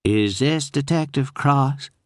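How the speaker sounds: a hesitant Southern drawl on the phone; Southern accent. Acted. Unknown woman calling the detective in a Southern accent. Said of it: "with a heavy Southern accent".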